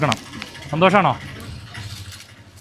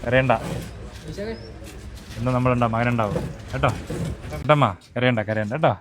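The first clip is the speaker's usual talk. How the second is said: gentle comforting; 3 semitones lower, 6 dB quieter than usual. Real, not acted. Comforting a crying old woman.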